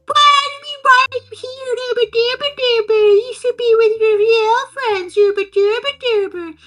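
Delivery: high, sing-song mock nagging; a hammed-up squeaky taunt with nonsense babble; mocking whiny friends.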